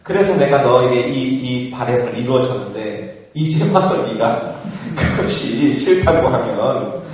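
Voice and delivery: ordinary talk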